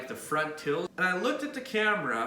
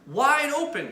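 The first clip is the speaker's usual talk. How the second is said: higher, alarmed call to a coworker; the pharmacist, mildly raised and worried; pharmacist's alarmed shout; 8 semitones higher, 4 dB louder than usual. Retold or imitated. Pharmacist calls out to Kirk, a higher alarmed call. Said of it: "And George is like".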